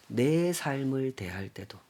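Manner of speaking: ordinary talk, nothing marked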